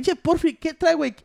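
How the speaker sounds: high-pitched retold challenge; indignant challenge. Retelling a run-in with Porfi in the street, indignant challenge.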